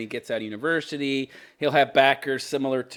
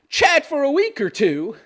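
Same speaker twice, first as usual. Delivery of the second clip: dopey female voice; 11 semitones higher, 4 dB louder than usual. Retold or imitated. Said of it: "do the female voice".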